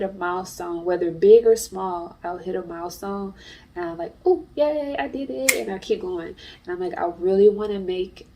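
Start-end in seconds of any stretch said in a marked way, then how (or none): none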